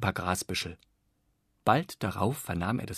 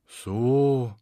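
disappointed; 3 semitones higher, 6 dB louder than usual. A narrator's reading. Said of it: "machte die Langeweile enttäuscht".

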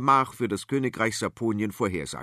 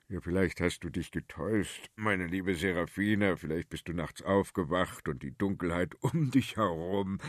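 put-on calm old king; 4 semitones lower than usual. King soothes queen.

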